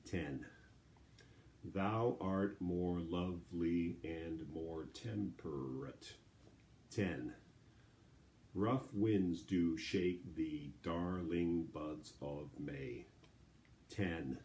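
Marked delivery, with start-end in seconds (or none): 1.6-6.1 slow, every syllable separated evenly; measured, drawn-out syllable count. Shown as a demonstration. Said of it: "let's try that real quick". 8.5-13.0 slow, every syllable separated evenly; measured, drawn-out syllable count. Shown as a demonstration. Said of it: "let's try that real quick".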